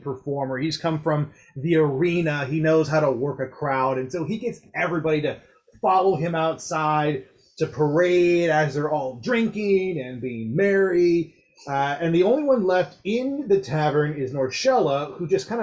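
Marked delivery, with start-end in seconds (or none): none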